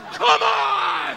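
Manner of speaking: high, loud mock strain; drawn-out comic holler of frustration; exasperated yell. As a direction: yell at a stuck valve, hammed up. Acts out fight with toilet valve.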